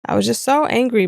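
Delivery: normal talking voice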